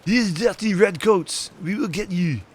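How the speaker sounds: high, slow hammed-up French accent; mock French accent. Mocking Stefan with his old French accent. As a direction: growl it out in a cartoon French accent. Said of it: "his French accent totally went out the window".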